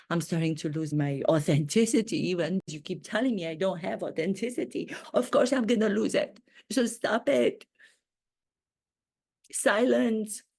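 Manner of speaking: ordinary talk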